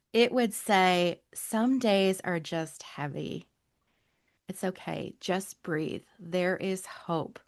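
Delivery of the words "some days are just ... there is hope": slow, soft and low, every phrase given room; soothing billboard message. Acted. Guest reads her billboard slogan to listeners, slow and soothing. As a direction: speak it like a calming reassurance to someone struggling.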